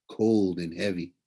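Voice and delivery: ordinary delivery, nothing marked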